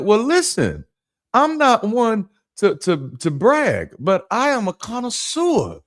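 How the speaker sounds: high, slow, hammed-up self-importance; mock boastful. Guest answers lady's whiskey offer, mock boastful. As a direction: draw it out like a grand humble-brag.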